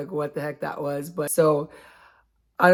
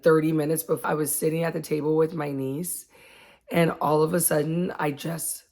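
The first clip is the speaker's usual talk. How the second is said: ordinary talk, nothing marked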